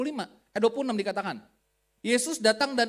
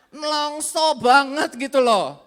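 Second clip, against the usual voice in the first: exaggerated exclaim; 7 semitones higher, 11 dB louder than usual. Exclaiming at friend.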